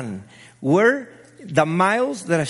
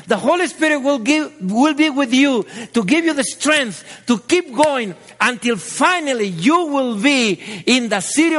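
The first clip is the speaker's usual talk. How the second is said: raised, rising preacher's exhortation; preaching at full force; 6 semitones higher, 4 dB louder than usual. Acted. Pastor urging believers on, preaching with fire. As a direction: lift the congregation to the finish line.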